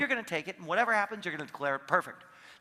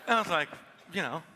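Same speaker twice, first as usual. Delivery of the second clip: ordinary talk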